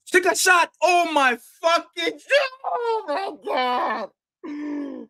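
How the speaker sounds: high, loud yell of disbelief at the screen; real frustrated shout. Real, not acted. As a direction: scream at the missed shot. Watching a missed chance live.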